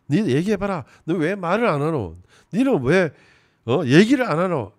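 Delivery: high, loud badgering demand in Gyeongsang dialect; yelling at the kids. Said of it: "애들한테 윽박지르면서".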